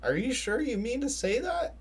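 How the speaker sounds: high, baffled disbelief; retold startled question; incredulous what. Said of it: "I had to stop and question and be like".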